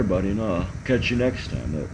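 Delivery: ordinary talk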